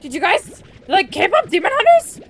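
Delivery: high, loud, gushing fangirl squeal; mock overexcited fangirl gushing about K-pop; hyper-excited fangirl. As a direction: gush like an overexcited K-pop fan. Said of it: "she'd be like".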